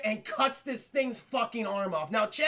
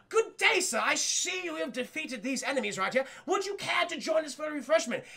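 high, formal little-dog voice; courteous wiener dog; 7 semitones higher than usual. Acted. Little dog inviting Jack for refreshment, courteous and high. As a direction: greet him with high-pitched, ornate politeness.

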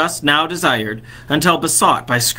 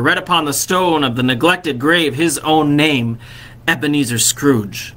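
ordinary delivery

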